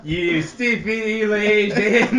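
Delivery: loud, laughing fake Scottish accent; bad Scottish accent. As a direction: bark it in a hammy Scottish brogue. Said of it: "a French person trying to do a Scottish accent".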